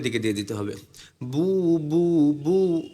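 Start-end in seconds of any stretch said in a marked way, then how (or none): 1.2-2.8 long "বু" with rounded lips; slow, held "বু" drill; rounded pesh sound. Shown as a demonstration. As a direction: round the lips and send each "বু" forward. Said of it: "ঠোঁট গোল করে আওয়াজকে সামনের".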